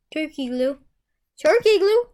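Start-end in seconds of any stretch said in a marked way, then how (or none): 1.4-2.0 louder and higher, playful; loud playful repeat. Pretend. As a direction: belt out the silly name. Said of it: "repeats the name twice".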